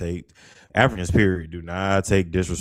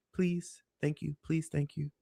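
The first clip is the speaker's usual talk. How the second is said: soft, higher-pitched polite imitation; tiny, courteous voice, barely raised; 7 semitones higher, 8 dB quieter than usual. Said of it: "they're very polite".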